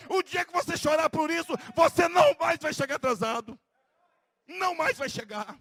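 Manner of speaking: loud, forceful preacher's exhortation; fiery preaching. Acted. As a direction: drive the call to weep home. (pregação inflamada e forte)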